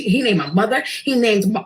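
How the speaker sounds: usual speaking voice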